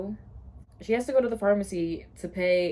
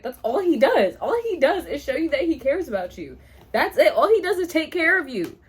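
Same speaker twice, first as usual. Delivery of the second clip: higher, louder, emphatic protest; exasperated insistence; 6 semitones higher, 5 dB louder than usual. Real, not acted. Reader arguing with a character's complaint, exasperated insistence. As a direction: insist on it, pitch jumping up. Indignant reaction to a book line.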